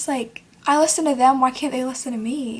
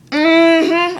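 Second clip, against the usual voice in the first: loud, higher, drawn-out hum; 5 semitones higher, 8 dB louder than usual. Retold or imitated. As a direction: imitate an irritating come-on hum. Mimics boy hitting on her.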